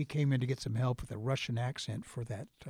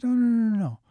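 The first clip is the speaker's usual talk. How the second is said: high, loud string of no's; insist, delighted, he must stay; eager insistence; 11 semitones higher, 8 dB louder, faster than usual. Retold or imitated. Teacher insists Robin stays.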